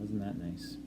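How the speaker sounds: normal talking voice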